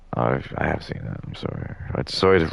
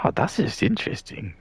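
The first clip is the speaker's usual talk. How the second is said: naive future self; 10 semitones higher, 4 dB quieter than usual. Pretend. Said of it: "I'd be like".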